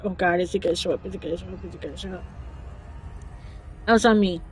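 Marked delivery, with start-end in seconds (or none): 0.0-2.2 quick, fading worried mutter; anxious muttering. Retold or imitated. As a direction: fret under your breath, dying away.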